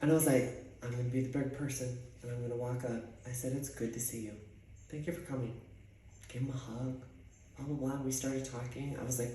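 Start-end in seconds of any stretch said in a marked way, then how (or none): 0.8-5.5 soft, low, understated retold greeting; polite, restrained. Being the big person, polite greeting.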